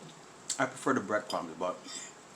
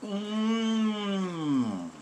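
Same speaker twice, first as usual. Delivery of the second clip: savoring mmm; 12 semitones higher than usual. Real, not acted. Tasting the food.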